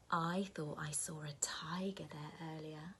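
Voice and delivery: soft secretive whisper; mysterious whisper. Acted. Grandma hinting at a tiger, whispered.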